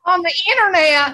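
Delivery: words squeezed out through high laughter; giggling through "On the internet"; laughing. Real, not acted. Cracking up at own impression.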